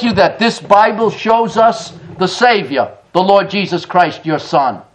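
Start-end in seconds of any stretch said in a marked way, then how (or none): none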